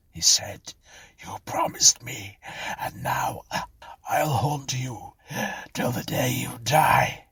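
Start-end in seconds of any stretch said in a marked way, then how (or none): 1.2-7.3 drawn-out vengeful threat, higher pitched; menacing curse. A narrator's reading. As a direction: swear to haunt her, word by word. Dying David curses Clara.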